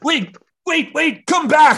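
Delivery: high shout. Acted. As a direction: yell after him. Calling after escapee.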